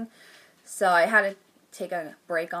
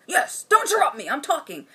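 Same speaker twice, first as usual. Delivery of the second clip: higher, louder bossy retort; mock husband snapping at his wife, higher and louder; pompous mock husband; 6 semitones higher, 4 dB louder than usual. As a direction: brush her off grandly. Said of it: "he's like".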